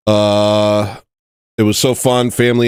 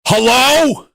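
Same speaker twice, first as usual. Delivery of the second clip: yelled hello. Retold or imitated. Waking himself up yelling at the demon. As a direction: belt it out into the night. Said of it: "That's how I beat the demon".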